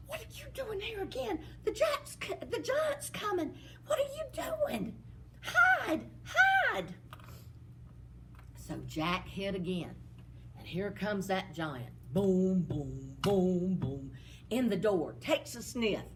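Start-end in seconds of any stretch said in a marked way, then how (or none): 0.1-6.9 put-on high, frantic servant girl voice; a scared girl urging him to hide. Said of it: "And the girl said". 12.1-14.1 giant footsteps. Acted.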